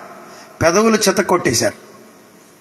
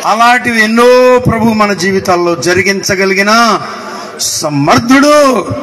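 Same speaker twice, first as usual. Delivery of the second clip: raised, ringing sermon delivery; fervent preaching; 4 semitones higher, 3 dB louder than usual. Real, not acted. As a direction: preach it out, stretching the phrase endings. Preaches at full force.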